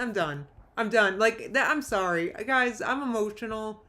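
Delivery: ordinary delivery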